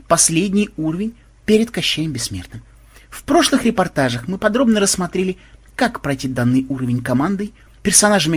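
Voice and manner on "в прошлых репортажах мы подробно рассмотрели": ordinary delivery, nothing marked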